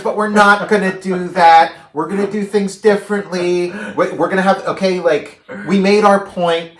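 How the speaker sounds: hammed-up speech to a crowd; Caesar's voiceover. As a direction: proclaim it high and loud, easing off as it winds down. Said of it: "it's this voiceover of".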